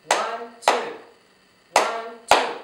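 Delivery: ONE, TWO as separate even beats; two-beat count. Shown as a demonstration. Teacher showing two syllables, clapped count of ONE, TWO. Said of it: "You can join me".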